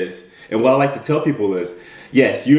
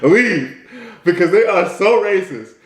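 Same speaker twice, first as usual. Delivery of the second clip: higher voice breaking into chuckles; laughing; 5 semitones higher, slower than usual. Real, not acted. Speaker riffs on his Southern boss bit, laughing. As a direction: joke through your own laughter.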